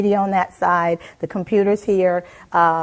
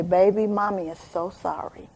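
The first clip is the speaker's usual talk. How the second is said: put-on mother hushed apologizing to her child; tender apology; 5 dB quieter than usual. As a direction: hold her close and promise gently. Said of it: "and I say".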